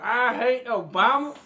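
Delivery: put-on thick Arkansas accent, high and loud; hammed-up Arkansas accent rant; [name]. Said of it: "thick accent that day".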